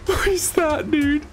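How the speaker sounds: high squeaky alarm, dragged out; high freaked out. Real, not acted. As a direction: sound totally spooked. Creepy mob approaching.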